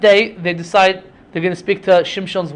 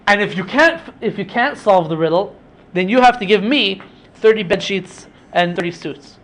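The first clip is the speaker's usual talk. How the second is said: ordinary delivery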